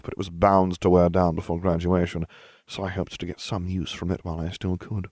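usual speaking voice

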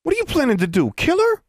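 high-pitched, challenging drawl; drawling girl. A narrator's reading. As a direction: confront the bullies in a drawl. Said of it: "that familiar drawl again".

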